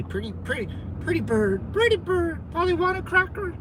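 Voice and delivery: high squawky parrot talk; mock a parrot begging for a cracker; talking like a pet bird.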